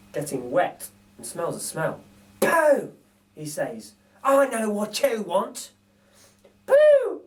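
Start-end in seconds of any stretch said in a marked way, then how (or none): 2.4-2.9 higher, louder mock disgust; hammed-up sniff of disgust; disgusted burglar. Narrator voices Bill smelling a wet nappy, mock disgusted. 4.2-5.7 slow, higher knowing voice; lightly voiced thief; knowing burglar. Narrator voices Bill guessing what baby wants, knowing. Bill's voice in read story. 6.6-7.2 put-on very high, louder toddler voice; baby voice. Narrator voices baby repeating a name, squeaky. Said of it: "says the baby".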